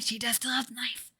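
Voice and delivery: breathy unvoiced whisper; conspiratorial whisper to the viewers. Shown as a demonstration. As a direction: hush it like a secret aside. Repeats line, then whispers it.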